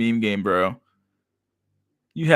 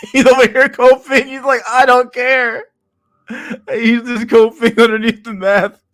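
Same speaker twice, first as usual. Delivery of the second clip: very high, loud laughing talk; 13 semitones higher, 7 dB louder than usual. Real, not acted. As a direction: giggle the words out up high. Laughing while mocking poster.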